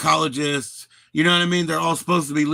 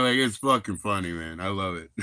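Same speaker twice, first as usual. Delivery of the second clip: ordinary talk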